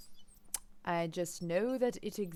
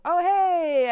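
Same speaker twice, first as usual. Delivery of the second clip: sudden high loud oh hey; surprised; 10 semitones higher, 12 dB louder than usual. Real, not acted. Arriving unexpectedly, a surprised high shout. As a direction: burst out with surprise.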